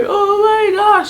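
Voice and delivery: very high, loud, drawn-out wail; mock bawling. Mother-to-be told it's a girl, mock bawling. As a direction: wail it as if bursting into tears, hammed-up. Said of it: "Started bawling".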